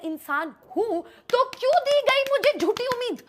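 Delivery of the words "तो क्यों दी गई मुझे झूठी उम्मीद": high, fast, exasperated rant; indignant outburst. Real, not acted. Ranting to the audience about false hope, high and fast. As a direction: demand an answer from fate. Angry about not being invited.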